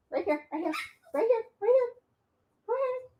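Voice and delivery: high, sing-song coaxing to a puppy. Real, not acted. Host coaxing her puppy onto the pee pad, high coaxing voice.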